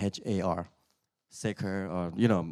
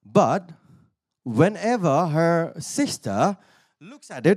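ordinary talk, nothing marked

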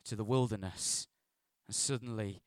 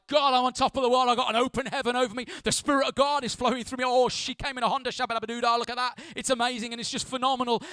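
much higher and louder, gushing euphoria; mock ecstatic high; 10 semitones higher, 10 dB louder, faster than usual. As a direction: ham up the mountaintop rapture. Mocking spiritual high.

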